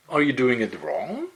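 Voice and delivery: normal talking voice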